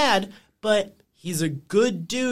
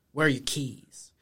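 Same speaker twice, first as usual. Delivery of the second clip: low-key retold question; 6 semitones lower, 5 dB quieter than usual. First take of retold upset Andy.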